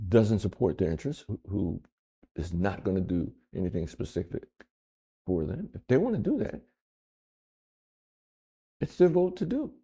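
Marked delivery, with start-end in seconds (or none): 5.7-9.7 exasperated. Real, not acted. Exasperated about voters.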